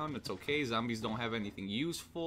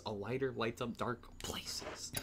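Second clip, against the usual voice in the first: slow breathy whisper of the obvious; 2 semitones lower, 6 dB quieter than usual. Pretend. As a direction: whisper it like a silly secret. Mock-reads item description.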